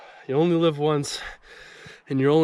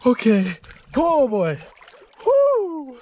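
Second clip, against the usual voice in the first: loud, high exhilarated cry; excited whoop; 12 semitones higher, 6 dB louder, much slower than usual. Real, not acted. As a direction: shout out the thrill. Just dunked in icy water.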